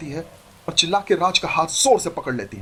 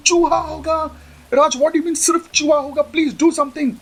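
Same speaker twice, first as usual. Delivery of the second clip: very high, hysterical disbelief; high panicked squeal; panicked shriek; 12 semitones higher, 4 dB louder, slower than usual. Acted. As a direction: shriek it in horror. Bride panicking over mouse.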